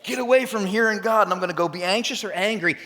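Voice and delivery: high, loud fretting self-talk; anxious inner voice. Retold or imitated. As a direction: play the panicking controller. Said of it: "we go".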